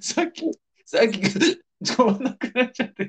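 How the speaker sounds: hiccuping, voice jumping up; with hiccups. Real, not acted. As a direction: talk through the hiccups. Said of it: "しゃっくり止まんなくなっちゃって".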